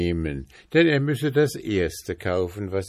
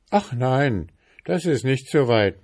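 lightly voiced prince, higher and louder; prince making light; 7 semitones higher, 4 dB louder than usual. A narrator's reading. Prince says home is near, lightly raised voice. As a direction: brush off the worry breezily.

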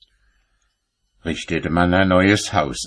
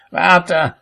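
high, sharp threat to an apprentice; threatening; 10 semitones higher, 3 dB louder than usual. A narrator's reading. Cobbler threatens his apprentice, high-pitched and menacing. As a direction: raise the pitch, threaten the lad.

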